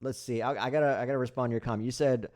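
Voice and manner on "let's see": ordinary delivery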